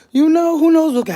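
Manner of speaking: excited outburst. Real, not acted. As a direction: let the excitement spill over. Excited storytelling peak.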